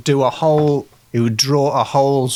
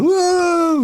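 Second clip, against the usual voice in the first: mock crowd cheer. Crowd going wild at wrestling.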